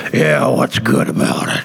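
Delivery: low gruff growl; growly voice. Retold or imitated. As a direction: growl it like a grumpy old man. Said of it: "in a growly voice said".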